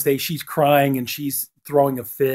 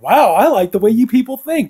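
higher and louder mock delight; delighted Gomez; 10 semitones higher, 7 dB louder than usual. Gomez praises the school board ladies, higher, louder and gleeful. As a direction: gush with glee, well up in the voice. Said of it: "And Gomez is like".

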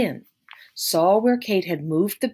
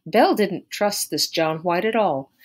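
ordinary delivery

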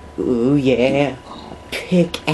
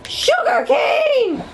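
high, slow, laughing shout of sugar cane; gleeful high shout; excited shout; slower than usual. Real, not acted. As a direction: crow with delight. Excited discovery.

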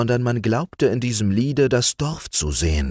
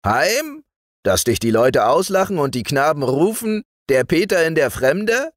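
sneering high rise on Heim, higher throughout; mocking; 9 semitones higher, 3 dB louder than usual. A narrator's reading. Magician mocks the youth for wanting to go home, sneering higher. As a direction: taunt the boy with mock disbelief. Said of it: "lächelte er wieder und spottete".

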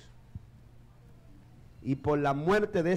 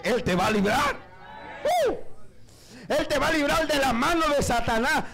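fervent preaching; 9 semitones higher than usual. Acted. Pastor assures the congregation God will deliver them, fervently. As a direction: lift the church with the promise.

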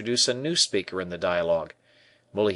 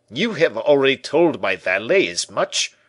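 high, loud, put-on grumble; discontented; 6 semitones higher, 7 dB louder than usual. A narrator's reading. Porthos complains to the tailor about being put off, discontented.

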